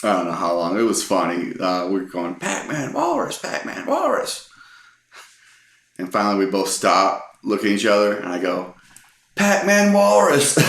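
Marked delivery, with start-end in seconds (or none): 2.3-4.5 rapid back-and-forth, pitched way up; raised voices. Retold or imitated. Two friends bickering in the theater, rising squeaky yells. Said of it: "we start raising our voices towards each other". 9.3-10.6 punchline shout. Retold or imitated. Final punchline after both stop.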